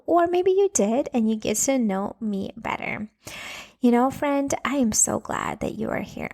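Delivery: normal talking voice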